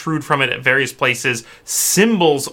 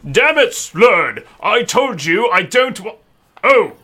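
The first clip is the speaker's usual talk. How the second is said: loud, raised angry knight bellowing; 8 semitones higher, 4 dB louder than usual. Acted. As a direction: roar at the servant then catch yourself. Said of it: "he looks at you and he says".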